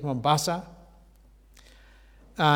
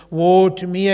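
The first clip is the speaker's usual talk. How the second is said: woe cried out much louder; anguished cry; 2 semitones higher, 12 dB louder than usual. Acted. Preacher voicing Isaiah crying out before God, loud anguished cry. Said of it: "I cried".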